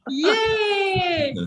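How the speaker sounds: high shouted yay; cheering yay. Shown as a demonstration. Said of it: "give yourself an applause".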